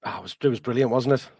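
ordinary talk

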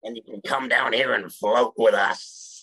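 a menacing clown's invitation; Pennywise voice. Retold or imitated. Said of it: "That was amazing slash horrifying".

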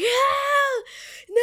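high, loud drawn-out wail; freak-out wail. Retold or imitated. Teen retelling her airport meltdown, hammed-up freak-out wail. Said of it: "I start freaking out".

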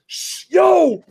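high, loud goblin hush; high laughing goblin shout. Retold or imitated. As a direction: call out like a scared goblin. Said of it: "the pig goblin's like".

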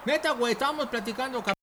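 hushed talk. Acted. Caught friend talks low through the door, hushed. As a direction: keep your voice down so he won't hear.

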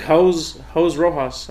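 Jose as one syllable, "hoze"; Jose Rojas with Jose rhyming with rose; name said wrong. Shown as a demonstration. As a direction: drop the last vowel of Jose. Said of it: "how would you say my re-, name wrong?".

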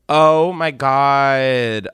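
loud, drawn-out delight; very slow, emphatic excitement; thrilled realization. Real, not acted. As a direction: stretch it out, loud and amazed. Thrilled by the mukbang idea.

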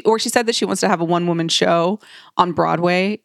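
usual speaking voice

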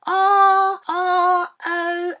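loud spelled-out growl; growled letters. Retold or imitated. Boy spelling out a dog's growl for dad, loud. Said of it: "It's got a woof".

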